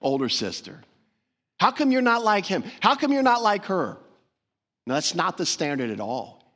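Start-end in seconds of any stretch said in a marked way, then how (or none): 1.6-4.0 high, loud accusing question; mock scolding parent; nagging parent comparison. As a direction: whine it like a parent comparing you to a sibling. Imagined parent comparing siblings.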